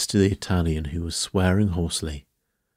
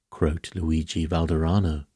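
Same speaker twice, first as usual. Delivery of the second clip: ordinary delivery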